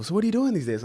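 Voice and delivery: high, chirpy aunt asking. Retold or imitated. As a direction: pitch up like a curious aunt. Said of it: "when my aunt, like, asked me".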